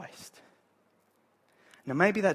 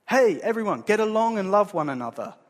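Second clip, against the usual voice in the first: raised, higher voice calling out; mock announcement; 5 semitones higher, 8 dB louder than usual. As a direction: address the whole crowd brightly and loudly. Mock announcement of a simple message.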